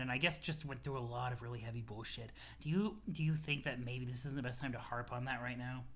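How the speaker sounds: low, soft, careful voice; subdued tactful friend. Acted. Friend checking Aradia's enthusiasm, low subdued voice. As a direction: ease them off it, low and quiet.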